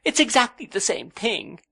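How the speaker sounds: high, insistent protest; cried. A narrator's reading. Young wife insists to her husband, high and tearful. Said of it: "cried Dora".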